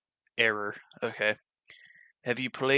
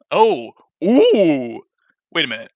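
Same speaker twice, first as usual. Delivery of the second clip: surprised; 5 semitones higher, 12 dB louder, slower than usual. Real, not acted. Sudden realisation about achievements.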